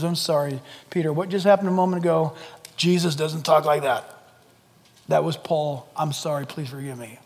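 low, soft, sheepish apology; contrite apology. Acted. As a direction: apologise quietly, humbled by guilt. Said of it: "he's convicted".

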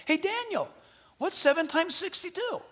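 high, bright-voiced angel asking; a playful angel quizzing Daniel. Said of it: "Then he said".

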